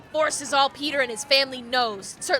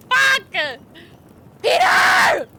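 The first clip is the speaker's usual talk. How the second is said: shout; 11 semitones higher, 8 dB louder, much slower than usual. Acted. Books burning, calling out.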